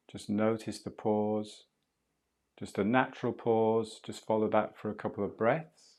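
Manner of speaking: calm, unhurried meditation guide voice; soothing breathwork guide talking a student through the breath; guided breathing meditation. Acted. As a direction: ease the listener through each breath, gently.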